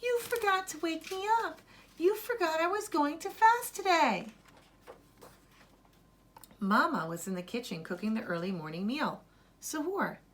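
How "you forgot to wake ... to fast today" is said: put-on high, upset boy complaining; high child voice, a touch of reproach; Zaki's child voice. Boy tells Mama she forgot to wake him, high.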